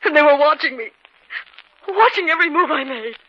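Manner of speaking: hysterical. Acted. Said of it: "She was almost hysterical".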